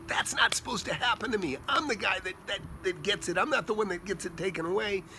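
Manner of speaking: mock indignation at losing out; taking it hard. Investor to himself after losing a deal, protesting. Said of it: "I take it hard".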